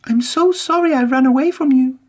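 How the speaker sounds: high, earnest apology; apologize, high and sincere; puffing Emily. A narrator's reading. Emily says sorry to Whiff, high and earnest. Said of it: "puffed Emily".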